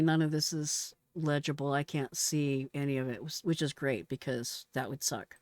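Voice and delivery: normal talking voice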